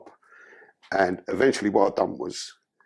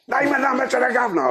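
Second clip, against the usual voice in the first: much higher and louder, a sharp official yell; angry officer's order; 5 dB louder than usual. Retold or imitated. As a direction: yell the order again with real impatience. Officer repeats order, angrier.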